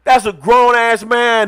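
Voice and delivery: loud, high mock outrage; high-pitched, exasperated hollering; exasperated retold protest. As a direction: yell it in hammed-up indignation. Retells arguing with the waitress.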